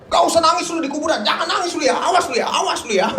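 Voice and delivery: mock scolding. People telling mourners off for crying at the grave, mock stern. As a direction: wag a finger at a crying mourner.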